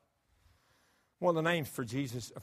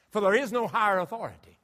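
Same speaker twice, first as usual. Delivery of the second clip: ordinary delivery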